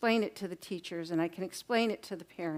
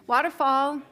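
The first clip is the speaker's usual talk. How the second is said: calling out; 4 semitones higher, 7 dB louder than usual. Real, not acted. Calling class back to attention.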